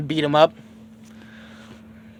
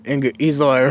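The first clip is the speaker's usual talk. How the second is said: ordinary delivery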